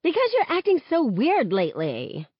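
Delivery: snapping back. A narrator's reading. Haley snapping back at Star, mild snap. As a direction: fire it right back at her. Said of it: "Haley snaps back".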